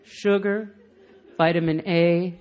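solemn, spaced-out poem recital; mock-poetic, with long pauses; poetry reading. Shown as a demonstration. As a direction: read each word like a precious line of verse. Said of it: "I'm gonna read this like a poem".

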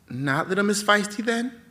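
high, teasing taunt; mocking taunt. Announcer taunts her.

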